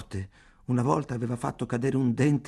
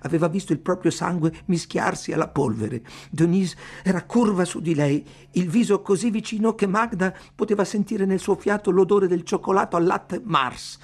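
urgent, raised voice building in pitch and force; tense narration; 7 semitones higher, 4 dB louder than usual. A narrator's reading. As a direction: narrate the attack with mounting intensity. Narrator builds up the attack scene. (narrazione concitata, in crescendo)

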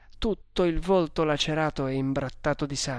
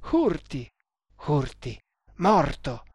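short cried-out words, last one loud; shocked exclamation; 3 dB louder than usual. A narrator's reading. Tremal Naik learning of the death, shocked exclamation. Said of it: "esclamò Tremal Naik".

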